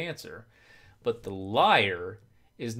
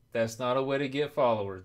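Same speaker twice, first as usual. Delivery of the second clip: ordinary talk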